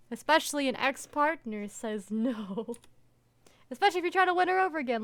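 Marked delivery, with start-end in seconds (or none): 3.7-5.0 fast heated rant. Real, not acted. Ranting at the character.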